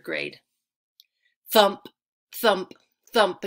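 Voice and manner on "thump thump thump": thumping. A narrator's reading. Kicks on the seat.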